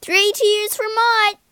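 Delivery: high, loud celebrating cry; joyful cheer. A narrator's reading. Egbert cheering for Mott the Wizard, high and joyful. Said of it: "he cried".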